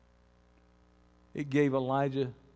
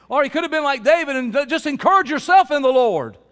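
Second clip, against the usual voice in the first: loud, high pulpit shout; full-force exhortation, pitched high; preaching climax; 12 semitones higher, 14 dB louder than usual. Real, not acted. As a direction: belt it out to the congregation.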